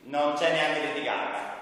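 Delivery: ordinary talk, nothing marked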